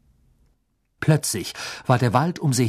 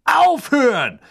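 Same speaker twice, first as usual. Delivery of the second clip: loud, much higher cry to stop; desperate shout; 12 semitones higher, 5 dB louder, slower than usual. A narrator's reading. A father screams at the wizards to stop firing, loud and desperate. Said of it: "schrie eine Stimme".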